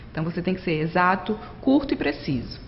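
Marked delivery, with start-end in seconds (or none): none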